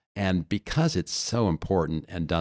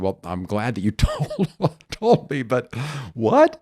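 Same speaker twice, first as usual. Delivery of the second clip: incredulous what; 7 semitones higher, 5 dB louder, slower than usual. Retold or imitated. Retold exasperated reply to client.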